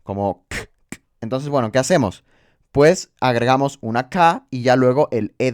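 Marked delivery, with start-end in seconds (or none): 0.5-1.0 bare "k" click with no vowel; isolated K sound. Shown as a demonstration. Teacher showing how the C sounds, an isolated "k". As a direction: pop out "k" alone, twice. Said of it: "está sonando como una K".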